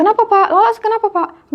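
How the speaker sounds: high-pitched retold worried questions; retold alarm. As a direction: imitate startled friends asking what happened. Woken friends ask alarmed.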